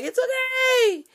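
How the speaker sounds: put-on very high, loud, slow squeaky kid brush-off; a caught kid insisting all is fine; guilty kid. Kid caught by mom, squeaky high loud denial. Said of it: "when you're a kid and you do something".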